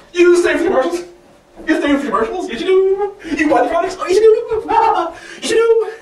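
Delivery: high, loud squealed teasing; mock taunting. Comedian teasing viewers who stay for commercials, squealed taunt. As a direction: needle them in a gleeful high voice.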